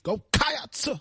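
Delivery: Cats! drawn out high like a sermon cry; preacher-style cheer. Shown as a demonstration. Co-host trying the cheer again, drawn out like a preacher. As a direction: hold Cats! like a preacher's call.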